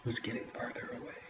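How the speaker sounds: soft, breathy suspense telling; hushed narration. A narrator's reading. Storyteller hushing as the creature fades away, soft. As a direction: drop to a hush for the tension.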